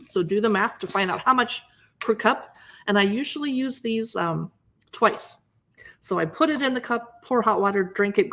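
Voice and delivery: normal talking voice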